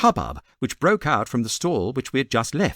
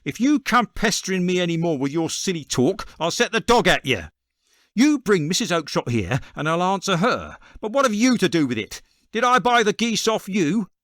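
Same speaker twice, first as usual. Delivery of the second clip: higher, raised angry salesman voice; a fed-up trader shouting down a pest; 6 semitones higher than usual. A narrator's reading. Salesman shouting at the cringing little man, angrily. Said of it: "he shouted".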